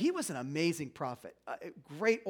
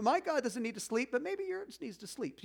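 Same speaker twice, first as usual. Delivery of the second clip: higher-pitched teasing jab; mock taunt; 5 semitones higher than usual. Elijah having some fun with the prophets, teasing. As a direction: needle them in playful trash talk. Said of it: "it's just trash talking".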